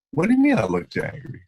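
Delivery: pushing back in disbelief; indignant surprise. Retold or imitated. Retold protest to colleague.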